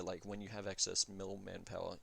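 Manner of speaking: ordinary talk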